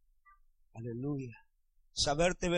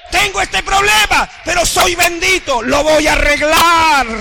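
louder and higher, belting it out; shouted declaration; 8 semitones higher, 14 dB louder than usual. Shown as a demonstration. Preacher to congregation, now shouting it loud and higher. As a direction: proclaim it like a victory cry. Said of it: "repeats it loud for the church".

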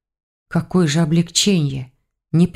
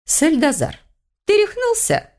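incredulous question; 11 semitones higher than usual. A narrator's reading. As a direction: ask as if he has gone mad. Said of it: "полюбопытствовала Ихтиндра".

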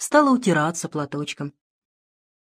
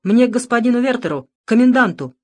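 pleading loudly; 5 semitones higher, 6 dB louder than usual. A narrator's reading. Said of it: "взмолился отец Александр".

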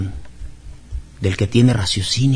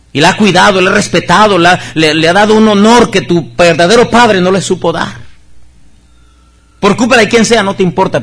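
higher, louder preaching voice; fervent preaching; 5 semitones higher, 6 dB louder than usual. Real, not acted. Pastor urging the congregation to give thanks, fervent loud preaching.

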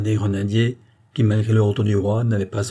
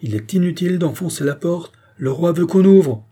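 high, raised public voice; loud announcement; 8 semitones higher, 4 dB louder than usual. A narrator's reading. Nobleman to rioters at the door, announcing loudly. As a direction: call through a door, swelling at the end. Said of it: "dit à haute voix".

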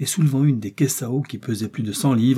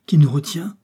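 higher, louder alarmed question; alarmed, raised voice; terrified cry; 5 semitones higher, 6 dB louder than usual. A narrator's reading. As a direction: cry out with a touch of terror. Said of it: "s'écria-t-il avec l'accent de la terreur".

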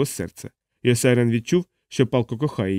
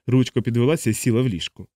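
ordinary delivery, nothing marked

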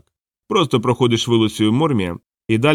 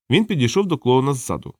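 ordinary delivery, nothing marked

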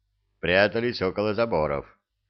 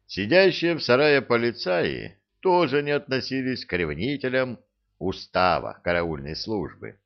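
usual speaking voice

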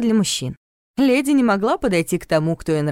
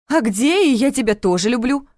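higher, louder pointed demand; 6 semitones higher, 4 dB louder than usual. A narrator's reading. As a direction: push him for the words. Heroine demanding love confession.

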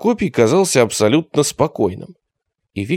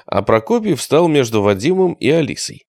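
plain reading voice

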